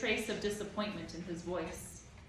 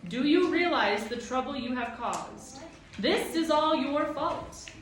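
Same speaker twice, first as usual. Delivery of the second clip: angry scolding; 6 semitones higher, 5 dB louder than usual. A narrator's reading. Proc blaming the children.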